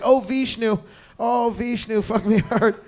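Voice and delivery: lightly hammed-up moan of passion; put-on cries of ecstasy; mock lover's cries. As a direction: imitate a lover crying out to a god. Mimicking a lover's cries in sex.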